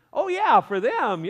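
high, loud mocking "oh yeah, for them"; sarcastic. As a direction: scoff at the idea it's for others. Mocking others get grace.

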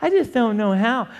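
high-pitched imitation of excuse-making; mock helpless doubter. Preacher mimicking people's nonsense excuses, mock helpless. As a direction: play a doubter at a loss, lightly mocking. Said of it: "that nonsense that people come up with".

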